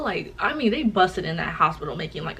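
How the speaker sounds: normal talking voice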